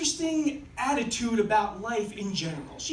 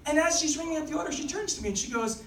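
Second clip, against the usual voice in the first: ordinary talk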